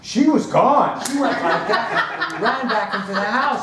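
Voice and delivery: loud, high-pitched burst of storytelling; excited telling. Retold or imitated. Storyteller on his friend bolting indoors, loud and excited. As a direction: exclaim it, big and high.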